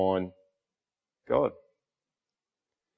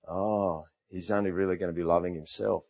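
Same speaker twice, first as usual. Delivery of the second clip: thoughtful; 4 semitones lower, 8 dB quieter than usual. Retold or imitated. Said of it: "he sat there and he thought".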